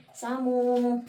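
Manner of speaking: lightly voiced child saying own name; child answering roll call. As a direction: call out the name like a kid in class. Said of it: "With the teacher say". (hinay nga tingog sa bata nga motubag sa maestra)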